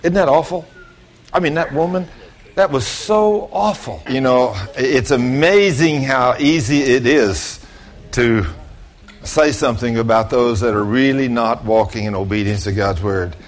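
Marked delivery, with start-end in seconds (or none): none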